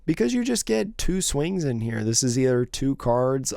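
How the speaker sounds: ordinary talk, nothing marked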